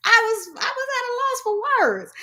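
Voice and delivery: high-pitched amused astonishment; incredulous high voice. Real, not acted. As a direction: squeal it, overcome by disbelief. Amazed and amused after laughing.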